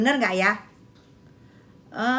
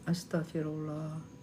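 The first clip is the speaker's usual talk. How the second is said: whispered sigh; 11 semitones lower, 15 dB quieter than usual. Real, not acted. Very soft exasperated sigh.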